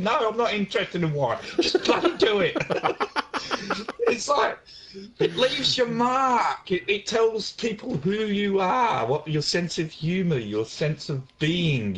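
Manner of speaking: high-pitched, hammed-up protest; mock exasperation. Mock-scolding friend for not naming animals.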